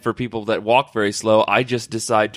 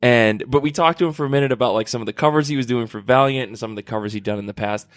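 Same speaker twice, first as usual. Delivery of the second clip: ordinary talk, nothing marked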